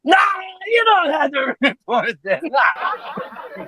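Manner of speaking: put-on high, whooping drunk protest; high-pitched mock holler breaking into laughter; drunken dismissal. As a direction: imitate a trashed buddy waving it off. Mitt's drunken dismissal, imitated.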